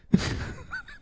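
high breathless squeal; real high laughing squeal. Real, not acted. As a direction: laugh helplessly. Laughing fit.

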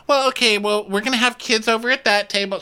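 quick, high polite hostess quote; high-pitched imitation. Quoting hostess explaining seating.

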